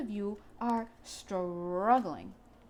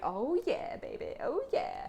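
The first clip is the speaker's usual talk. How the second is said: lion's excited purr; 6 semitones higher than usual. Pretend. Lion card voiced as excited.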